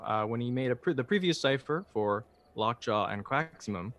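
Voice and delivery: ordinary talk, nothing marked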